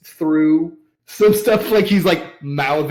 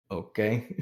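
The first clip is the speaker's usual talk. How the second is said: low, soft, unimpressed okay; muted reply; 5 semitones lower, 10 dB quieter than usual. Acted. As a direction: answer quietly, barely bothering. Old man's muted reply to taunt.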